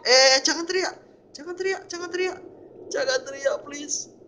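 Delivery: high, loud startled outburst; panicked pleading. Real, not acted. Startled by game character's sudden scream.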